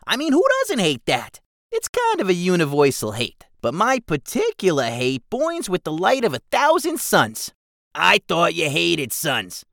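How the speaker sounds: high, loud goblin rant. Acted. Keswick rants to Jigsaw about bugs, high and loud. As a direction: build the rant like a fed-up goblin.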